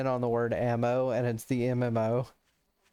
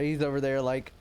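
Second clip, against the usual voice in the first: normal talking voice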